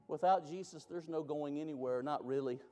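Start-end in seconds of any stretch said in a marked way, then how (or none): none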